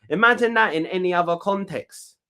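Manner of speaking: ordinary talk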